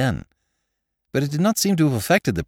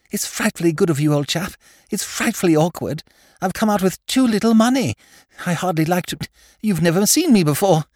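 much higher, eager silly-ass aristocrat voice; flustered young lord; 10 semitones higher than usual. Acted. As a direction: babble it out like a nervous young toff. Lord Drever asks Jimmy for money.

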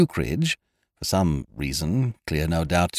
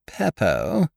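higher, questioning rise; doubtful; 6 semitones higher than usual. A narrator's reading. Hostess hearing the tonic's name, doubtful. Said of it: "said Lady Lakenheath doubtfully".

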